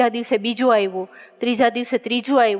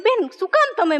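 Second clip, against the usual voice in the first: exasperated plea; 7 semitones higher than usual. Retold or imitated. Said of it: "મેં કીધું".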